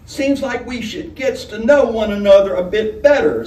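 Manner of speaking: high, loud, wheedling hostess voice; coarse tavern hostess. A narrator's reading. Hostess sidling up to the young man.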